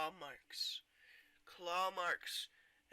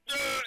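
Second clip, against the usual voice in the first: sharp high gasp, then loud growled yell; hammed-up waking roar; 10 semitones higher, 12 dB louder than usual. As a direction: gasp awake and roar in mock fury. Imitates hero gasping, roaring awake.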